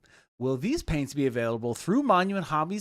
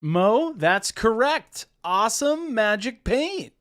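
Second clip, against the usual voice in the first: game show host; 6 semitones higher, 6 dB louder, slower than usual. Acted. Game-show style praise.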